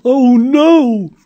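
high, loud alarmed cry; mother yelling. Acted. Mother crying out as Julie leaves, high loud. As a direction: shriek it in horror. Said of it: "Julie's mother yelled".

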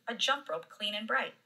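ordinary delivery

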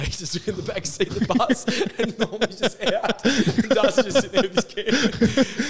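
can barely get it out for laughing; giggling retelling of the punch. Real, not acted. Laughing telling the bus story.

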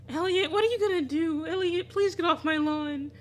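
high, loud frightened pleading; a high, loud scared plea; panicked plea. Real, not acted. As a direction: beg him to back off. Pleading with the stalker character.

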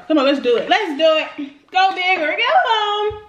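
loud, drawn-out hype; hype shout. Real, not acted. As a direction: pump yourself up loudly. Hyping himself up.

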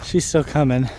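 breathless after the climb; panting between words; out of breath. Real, not acted. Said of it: "Sorry, I'm out of breath".

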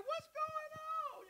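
very high, soft and slow, playing innocent. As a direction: squeak it out like a harmless little man. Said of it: "that's exactly how it was".

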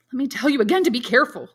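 high, loud and agitated; young man alarmed by his friend's hopeful words, excited; excitedly. A narrator's reading. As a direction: warn him in a rush of alarm. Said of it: "said Maximilian excitedly".

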